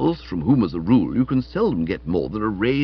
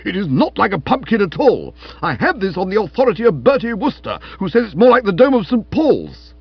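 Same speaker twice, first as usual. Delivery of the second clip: high, loud indignation building to a shout; outraged protest; 10 semitones higher, 7 dB louder than usual. Acted. Stilton contradicting Florence about his head, loud and outraged. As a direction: protest furiously, getting louder. Stilton escalates a childish squabble.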